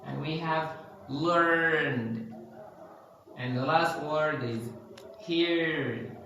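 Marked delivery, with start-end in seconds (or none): 1.1-2.3 "learned" drawn out on the R; slow, heavy American R in "learned"; stressed R. Shown as a demonstration. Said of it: "they stress the R". 5.2-6.2 "here" held on the final R; long American R in "here"; stressed R. Shown as a demonstration. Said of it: "they stress the R".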